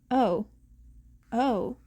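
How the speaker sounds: ordinary delivery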